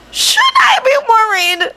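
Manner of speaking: high call from far off; worried call from afar; distant call. Acted. Kaminari calling from afar.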